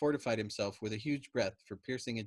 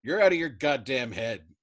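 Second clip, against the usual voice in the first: much louder forceful shout; emphatic shout; 3 semitones higher, 10 dB louder than usual. A narrator's reading. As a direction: hammer it at him. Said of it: "Yossarian shouted at him emphatically".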